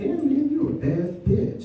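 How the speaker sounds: high, slow, gushing hype voice; squealing bad bitch hype; hyped-up compliment. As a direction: gush it up at her. Hyping girlfriend with put-on voice.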